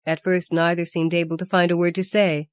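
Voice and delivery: plain reading voice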